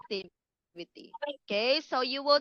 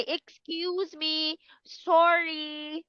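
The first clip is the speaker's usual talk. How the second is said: sleepy excuse; 5 semitones higher, slower than usual. Shown as a demonstration. Teacher playing a tired kid excusing herself, slow. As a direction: act too tired to go on. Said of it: "like that".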